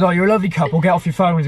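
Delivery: mimicking a Birmingham bloke, lightly done; Birmingham accent. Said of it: "He was from Birmingham".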